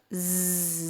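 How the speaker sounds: ز held as a long "zzz"; bee buzz Z. Shown as a demonstration. Teacher showing children Z, long bee-like buzz. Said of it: "زي صوت النحلة".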